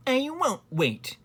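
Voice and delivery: high, drawn-out defiant refusal; talking back. A narrator's reading. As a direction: refuse the king, slow and high. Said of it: "talked back to the king".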